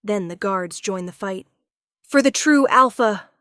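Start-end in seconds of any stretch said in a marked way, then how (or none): 2.0-3.3 high, loud war cry; guard shouting a battle cry. Acted. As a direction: roar it as you charge. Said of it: "one shouts".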